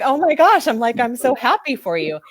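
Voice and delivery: high, delighted amazement; flabbergasted. Retold or imitated. Coach so happy for her client, flabbergasted. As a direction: retell it breathlessly thrilled for her. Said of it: "I was, like, flabbergasted".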